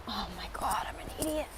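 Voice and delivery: hushed self-scolding whisper; embarrassed whisper. Real, not acted. Realises filming wrong way.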